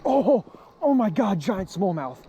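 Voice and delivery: high, loud and slow, bursting with joy; real excitement. Real, not acted. Angler landing a giant smallmouth, overjoyed. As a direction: gasp it out as the big fish comes in. Huge bass just landed.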